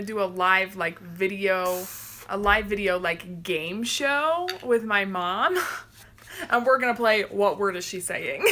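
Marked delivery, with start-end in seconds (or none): none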